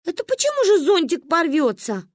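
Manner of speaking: put-on high-pitched, offended child's question; indignant challenge. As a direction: challenge it like an affronted kid. (возмущённо, высоким голосом)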